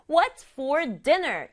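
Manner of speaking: what's for dinner said very slowly and clearly; slow model sentence. Shown as a demonstration. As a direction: spell the question out slowly for learners. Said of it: "요렇게 하면 되고". (천천히 또박또박 시범)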